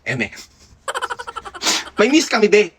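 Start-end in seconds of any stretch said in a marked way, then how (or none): 0.9-1.9 squealing high laugh. Real, not acted. Laughing at the muse joke.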